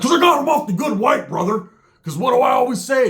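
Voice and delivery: high loud Roach. Retold or imitated. Roach bragging about the drugs, high and loud. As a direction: brag it high. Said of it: "Roach is like".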